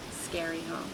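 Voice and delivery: plain reading voice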